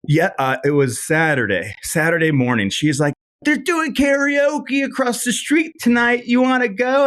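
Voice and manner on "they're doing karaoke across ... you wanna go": high-pitched imitation of an older woman inviting to karaoke; mock old lady voice. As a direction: squeak it out like the tipsy grocery store regular.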